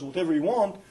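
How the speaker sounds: usual speaking voice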